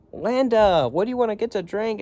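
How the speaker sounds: thrilled to be having coffee with her; excited greeting. Pretend. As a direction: hail her like an old friend you're thrilled to see. Calling out to Linda excitedly.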